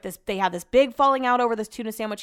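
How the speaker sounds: usual speaking voice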